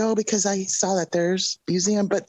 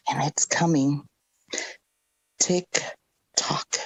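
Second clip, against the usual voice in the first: ominous whisper; 5 semitones lower than usual. Real, not acted. Ominous warning of vengeance.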